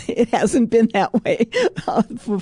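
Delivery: giggling admission; real laughter breaking in; laughing. Real, not acted. Laughing at own stubbornness.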